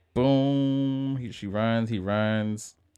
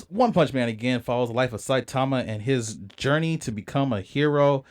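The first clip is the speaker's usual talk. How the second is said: normal talking voice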